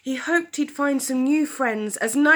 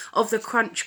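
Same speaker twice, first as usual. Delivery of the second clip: ordinary delivery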